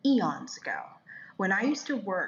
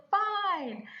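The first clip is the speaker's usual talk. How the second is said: high, drawn-out mock-grudging surrender; exasperated giving in; 12 semitones higher than usual. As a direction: give in like a nagged kid. Gives in to God's prompting.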